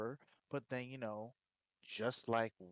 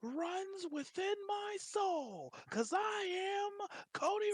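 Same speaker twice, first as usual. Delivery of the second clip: heroic shout. Retold or imitated. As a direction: declare it like an anime hero. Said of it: "He's all like".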